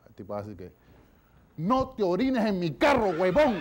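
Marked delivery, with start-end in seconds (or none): 1.6-3.6 angry yell. Acted. Car owner yelling at man peeing on his car, angry shout. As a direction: shout it slow and furious at the guest.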